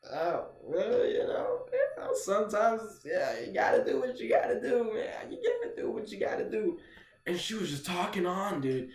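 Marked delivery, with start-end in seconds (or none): 0.1-6.8 high, wavering stoner mumbling; imitated stoned voice. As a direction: act too high to hold a conversation. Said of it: "I was stoned".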